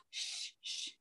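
unvoiced shh to hush the dog; shushing the dog. Real, not acted. Speaker hushing her barking dog mid-call, unvoiced shush.

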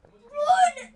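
loud high panicked yell; panicked shout. Real, not acted. Panic in game.

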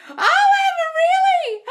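very high, loud shriek of delight and a gasp; thrilled shriek. Real, not acted. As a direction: shriek with joy for Amber. Viewer says SP wants to live together.

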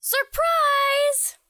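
high loud squealed surprise; gleeful surprise. Acted. Princess leaps from the treasure chest, high and gleeful. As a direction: burst out at them with delight.

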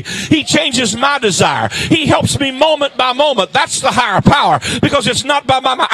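loud, impassioned testimony from the pulpit; full-force preaching. Real, not acted. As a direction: build to a shouted sermon climax.

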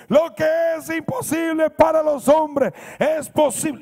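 high, very loud cry; full-voiced shout, cut off at the end; retold desperate shout. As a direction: yell the verse while held by the collar. Man shouts verse while grabbed.

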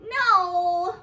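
loud hammed-up no; mock shout. Mom reading to Harper, hammed-up loud no. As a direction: protest like a trapped reader. Mock protest at more pages.

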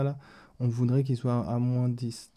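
normal talking voice

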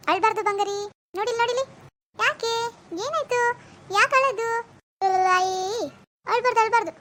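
put-on high-pitched cooing to a baby; soothing baby talk. Mother soothing a crying baby. (ಅಳುವ ಮಗುವನ್ನು ಮುದ್ದಿನಿಂದ ಸಮಾಧಾನಿಸುವ ದನಿ)

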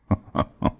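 short villain chuckle; brief mocking chuckle; amused laugh. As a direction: laugh lightly at the hero's grand speech. Villain laughs at hero's speech.

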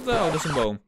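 normal talking voice

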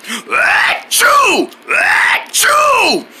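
two high, drawn-out mock achoos; hammed-up double sneeze; big pretend sneezes. Wrinkle Head's fit resumes, big pretend sneezes.

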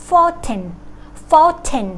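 "fountain" as high "fown", then a crisp aspirated "ten"; British clear T. Shown as a demonstration. Teacher modelling the British version, clear T in fountain. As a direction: keep a full puffed t in "fountain". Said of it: "ถ้าเป็นอังกฤษยังใช้เสียงตัว T อยู่".

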